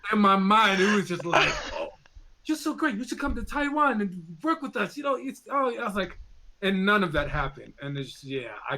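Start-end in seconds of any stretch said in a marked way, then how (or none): none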